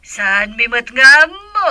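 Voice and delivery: normal talking voice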